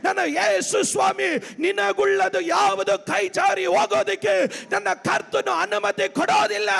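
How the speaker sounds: high, driving sermon shout; full-force preaching. Real, not acted. (ಪೂರ್ಣ ಶಕ್ತಿಯ ಉಚ್ಚ ಸ್ವರದ ಪ್ರವಚನ)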